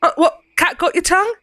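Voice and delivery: put-on soft Cockney accent. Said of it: "A soft Cockney accent".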